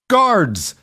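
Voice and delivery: sudden high, loud bark of Guards!; barked command. A narrator's reading. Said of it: "Charles barked".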